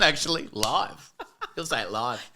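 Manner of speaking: words broken up by laughter; laughing. Real, not acted. Comic joking with co-host about saying it live, laughing. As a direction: crack up while getting the line out. Cracking up while teasing.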